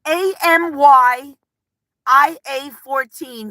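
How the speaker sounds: each letter slow and separate; slow, careful spelling of a name; spelled out slowly. Shown as a demonstration. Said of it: "I can't say it".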